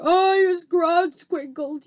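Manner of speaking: high, loud, slow giant yell. A narrator's reading. Trapped giant yells at the soldiers. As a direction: holler like a raging brute. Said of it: "yelled the blood bottler".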